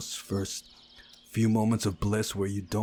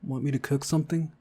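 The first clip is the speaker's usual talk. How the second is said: plain reading voice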